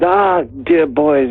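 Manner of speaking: drawn-out, high theatrical British address; Shakespearean thespian. Retold or imitated. Mate strikes a pose before naming the band, Shakespearean thespian. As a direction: hail them slowly like a hammy stage actor. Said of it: "Shakespearean thespian pose".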